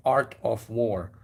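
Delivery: slow "Art of War", each word separate and OF sounded in full; careful self-correction. Real, not acted. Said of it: "I'm sorry".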